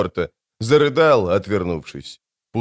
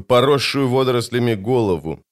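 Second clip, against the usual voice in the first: plain reading voice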